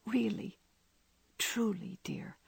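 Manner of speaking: soft whispered question. A narrator's reading. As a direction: breathe it almost silently. Laurie asks again softly.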